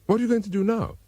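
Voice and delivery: a hint of a worried girl; Jessica's voice. A narrator's reading.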